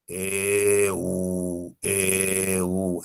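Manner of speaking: "E" and "U" drawn out on a low level tone; alternating held vowels. Shown as a demonstration. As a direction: stretch each "E" and "U" steadily, gliding between. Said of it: "A ver, pronuncia la E".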